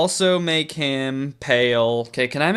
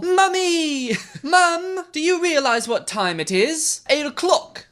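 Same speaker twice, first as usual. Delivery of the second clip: put-on a laughing, much higher go at a British accent; British accent attempt; 13 semitones higher, slower than usual. Mia whining to her mum in a badly faked British accent. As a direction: try a British accent and crack up. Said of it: "Do I have to do a British accent".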